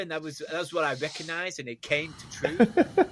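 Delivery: put-on high, laughing New York accent. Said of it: "I think you'll find that's the New York accent".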